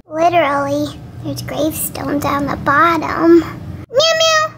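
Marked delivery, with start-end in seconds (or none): 3.4-4.5 high little kitty mews; cat meowing. Acted. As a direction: mew high like a waiting cat.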